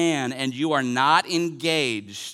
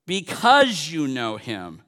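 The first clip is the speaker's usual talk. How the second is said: BECAUSE punched loud and drawn out; slow, emphatic "because", then let the rest fall; stress on because; 5 dB louder, slower than usual. Real, not acted. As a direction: lean hard and slowly on "because". Contrasts because with if.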